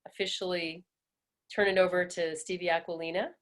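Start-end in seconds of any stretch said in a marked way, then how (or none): none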